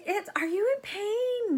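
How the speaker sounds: exaggerated caring tone to a sobbing patient; mock dentist voice. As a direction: mimic the dentist asking sweetly. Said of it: "and she's like".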